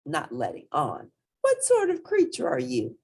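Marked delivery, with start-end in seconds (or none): 1.4-3.0 high mock-polite mother voice; mother teasing her son; mom playing along. A narrator's reading. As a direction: pretend not to know him. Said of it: "said Mom".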